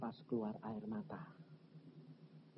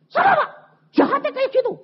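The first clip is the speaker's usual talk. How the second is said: loud, very high outraged outburst; acted anger; 9 dB louder, faster than usual. Husband demands the culprit from the pastor, acted anger. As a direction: imitate a raging husband at full pitch. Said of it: "Jadi dia marah-marah".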